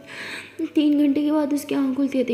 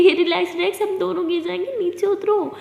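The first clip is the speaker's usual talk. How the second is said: laughing alarm; 5 semitones higher than usual. Acted. Partner jumps on her.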